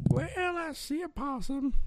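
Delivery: put-on high, wobbly old man voice; old grandpa voice. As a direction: play a creaky old grandpa. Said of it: "Old grandpa voice".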